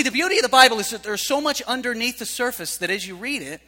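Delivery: normal talking voice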